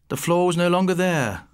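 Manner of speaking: ordinary delivery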